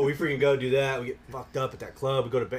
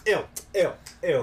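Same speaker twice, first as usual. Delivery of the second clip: disgusted; 5 semitones higher, 3 dB louder than usual. Retold or imitated. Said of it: "he goes".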